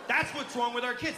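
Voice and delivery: ordinary talk, nothing marked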